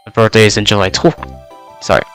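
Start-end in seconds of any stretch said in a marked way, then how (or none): none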